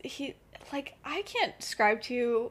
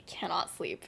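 hushed, whispered aside; 5 semitones lower, 8 dB quieter than usual. Real, not acted. Friend telling her story, whispering the detail.

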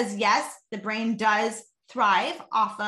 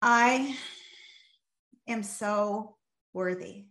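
heartfelt; much slower than usual. Shown as a demonstration. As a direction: hand on heart, take your time and mean it. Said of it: "That was my ten".

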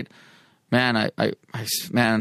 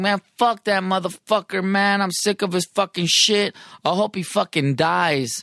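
pitched up, a mild mock tirade; retold rage; 6 semitones higher than usual. Son telling his mom on the phone, bitter mock rant. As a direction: vent like a fed-up son, without shouting. He rages about his dad.

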